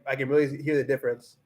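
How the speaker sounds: normal talking voice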